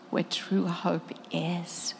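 slow, near-whispered promise; hushed call. Acted. Preacher voices a guide promising true hope, hushed and slow. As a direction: let it fade softly into a slow whisper. Voicing a rescuer's gentle call.

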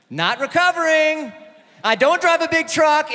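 loud, high mock anguish; mock breakdown. Comic to audience, hammed-up anguished cry. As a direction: ham up falling apart over the cut.

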